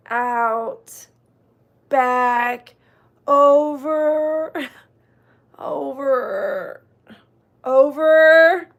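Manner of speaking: long strained words mid-stretch; held, effortful single words while leading exercise; stretching along. Real, not acted. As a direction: draw each word out through the stretch.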